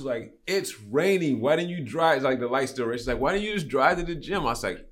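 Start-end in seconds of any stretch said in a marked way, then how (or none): 0.5-2.2 higher, louder mock disbelief; retold astonished questioning; incredulous imitation. Retells driver's incredulous questioning. 3.2-4.4 high-pitched mock bafflement; retold disbelief, pitched up; incredulous imitation. Retells driver's incredulous questioning.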